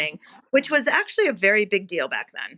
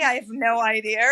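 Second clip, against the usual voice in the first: normal talking voice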